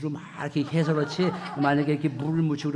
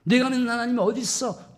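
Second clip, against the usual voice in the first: high jeer, less forceful; mocking taunt; 5 semitones higher than usual. People needling David about his God, mocking with restraint. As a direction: repeat the scoff without shouting. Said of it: "조롱하고 비아냥거리는 거예요".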